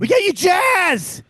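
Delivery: high, slow, ringing proud yell; booming, drawn-out boast; proud shout. Real, not acted. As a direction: shout it out for the whole South. Proud rant about the South.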